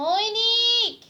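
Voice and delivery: loud, high drawn-out call. Real, not acted. Mother calling her sulking son to look at her, calling out.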